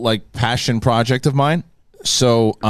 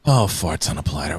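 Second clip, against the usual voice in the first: Clint Eastwood; 5 semitones lower than usual. Retold or imitated. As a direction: growl it like a weary old cowboy. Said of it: "Are you doing your, your Eastwood?".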